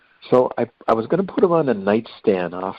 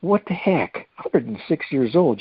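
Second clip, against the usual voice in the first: astonished; 8 semitones higher, slower than usual. Retold or imitated. Hearing patient is 106.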